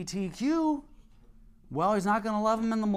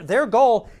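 "their goal" hit much louder; stress on goal; 4 semitones higher, 9 dB louder than usual. Real, not acted. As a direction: punch "their goal" hard. Preacher punches key phrase.

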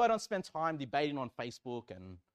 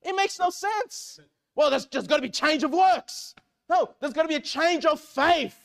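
loud, high, indignant preacher; forceful preaching; 11 semitones higher, 12 dB louder than usual. Real, not acted. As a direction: drive the argument home at full voice.

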